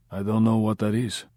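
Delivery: put-on quiet guttural Russian accent; lightly voiced Russian gangster, guttural; Russian loan shark brushing off the question in a guttural Russian accent. A narrator's reading. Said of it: "the tone quiet, guttural".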